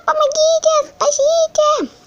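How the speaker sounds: high squeaky toy crying for help; fluttering cries for help. Pretend. (тоненькие крики о помощи)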